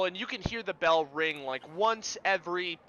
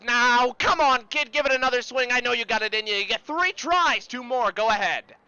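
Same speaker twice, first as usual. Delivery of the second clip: loud, raised carny coaxing; carnival barker; 5 semitones higher, 6 dB louder than usual. Acted. Carny urging a kid to try another swing, rousing barker's shout. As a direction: egg the player on like a fairground showman. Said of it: "And the carny says".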